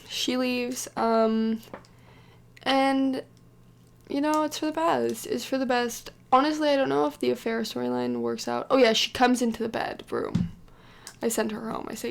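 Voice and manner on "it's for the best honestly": normal talking voice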